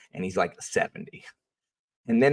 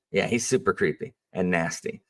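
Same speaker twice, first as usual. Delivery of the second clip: usual speaking voice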